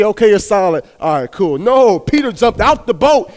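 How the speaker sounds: fiery preacher pushing the congregation; preaching at full force. Acted. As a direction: build the crowd up. Preaching climax.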